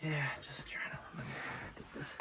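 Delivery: breathless whisper while climbing. Real, not acted. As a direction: whisper under strain. Climbing in tight cave spot.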